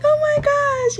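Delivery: high dramatic gasp of dismay; theatrical aunt sigh. Retold or imitated.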